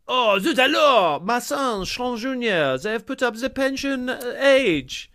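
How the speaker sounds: high, drawn-out hammy French; French dad accent. Acted. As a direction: lament like a comic French father. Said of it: "but we're French".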